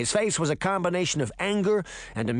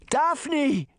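very high alarmed call; crying out; 13 semitones higher than usual. A narrator's reading. Granny calling her endangered granddaughter, crying out. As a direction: cry out to the child in alarm. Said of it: "Granny cried".

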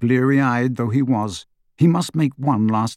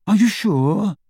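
higher-pitched, faintly wistful question; faint longing; 7 semitones higher than usual. A narrator's reading. Friend asking about giving up the wand, faintly longing. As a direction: ask with just a trace of longing. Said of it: "faintest trace of longing in his voice".

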